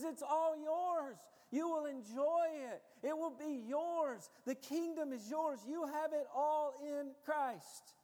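high, loud pulpit proclamation; full-force preaching. Real, not acted. Preacher voicing God's promise of the kingdom, full force. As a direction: build to a ringing climax.

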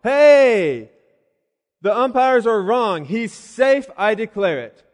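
long booming call, then emphatic shouted verdict; fan on a megaphone. Acted. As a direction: play a fan bellowing a ruling to the crowd. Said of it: "some fan saying on a megaphone".

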